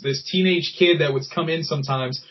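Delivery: ordinary talk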